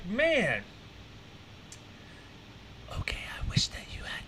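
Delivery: soft, fast breathy whisper into his ear; whispered sweet nothings. Pretend. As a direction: whisper it like a secret to the pilot. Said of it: "I'm gonna whisper sweet nothings".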